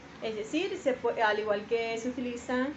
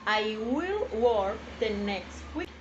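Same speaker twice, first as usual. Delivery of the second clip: even slower "I will work the next week"; slower repeat; 3 semitones lower, slower than usual. Shown as a demonstration. Teacher repeating the example slowly for students.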